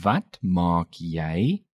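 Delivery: slow "Wat maak jy?" with "jy" rising at the end; slow, rising question. Shown as a demonstration. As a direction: draw out each word of "Wat maak jy?", lifting "jy". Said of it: "Note the rising intonation".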